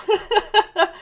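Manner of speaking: loud, high burst of laughter; high-pitched amused cackle. Real, not acted. Laughs after praising the woman.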